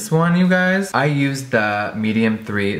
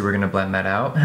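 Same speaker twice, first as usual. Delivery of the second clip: normal talking voice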